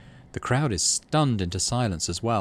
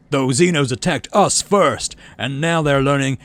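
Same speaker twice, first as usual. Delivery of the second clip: slow, loud, higher fist-raised proclamation; defiant rally speech; 3 semitones higher, 6 dB louder than usual. Acted. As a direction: hammer each word home to the crowd. Governor defends war to crowd.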